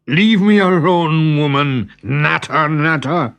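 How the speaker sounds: high, loud testy snap; husband snapping at his nagging wife; irritable outburst. Acted. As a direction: brush her off in annoyance.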